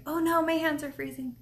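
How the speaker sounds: lightly panicked, replaying a worried thought; mock dismay. Recalling cold hands before the livestream, mock dismay. Retelling her earlier worried reaction.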